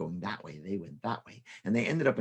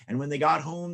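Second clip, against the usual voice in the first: usual speaking voice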